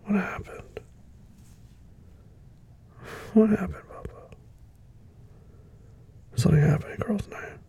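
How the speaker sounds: whispered, careful questions; gentle whisper. Acted. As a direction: ask softly what went wrong. Gently asking crying partner.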